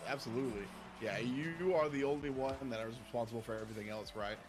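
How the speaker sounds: ordinary talk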